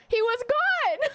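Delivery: high, drawn-out wail; upset. Real, not acted. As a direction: stretch it out high, close to tears. Tearful recap of sad story.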